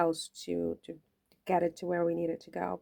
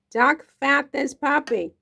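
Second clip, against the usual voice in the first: Duck fat this puppy said higher, louder and slow, savoring each word; hammed-up catchphrase; 7 semitones higher, 7 dB louder, slower than usual.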